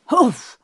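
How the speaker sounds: sudden high puff of breath; loud "hoof" as if hit in the belly; winded oof. A narrator's reading. Man run into at the stomach, winded grunt.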